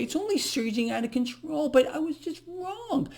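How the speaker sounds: high, whiny fake apology breaking into a cry; a hammed-up tearful official admitting fault; mock-sobbing Janet Yellen. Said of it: "I'm referring to Janet Yellen".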